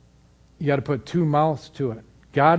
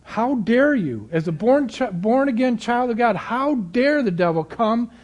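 rising, fervent preaching voice; stirring the congregation, climbing in pitch; 6 semitones higher, 3 dB louder than usual. Acted. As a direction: build the sermon to a higher pitch.

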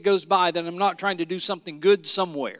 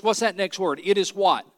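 ordinary talk

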